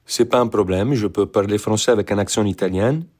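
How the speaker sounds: French with a lightly put-on Italian accent; français avec un accent italien. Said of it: "Französisch sprechen mit einem italienischen Akzent".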